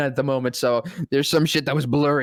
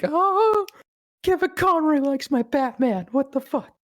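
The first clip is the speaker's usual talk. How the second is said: much higher, giddy fanboy squeal; stunned disbelief; 11 semitones higher than usual. Retold or imitated. Fan reacting to his hero's praise, high squeal. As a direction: squeak it out in shocked delight.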